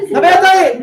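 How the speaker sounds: loud. Real, not acted. Teacher stops students' objections.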